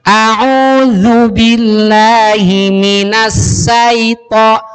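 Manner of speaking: very slow recitation with sai instead of sya; mispronounced syaitan. Retold or imitated. Student reciting to his teacher, misreading. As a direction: drag it, syaito with a plain s. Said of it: "bukan sai".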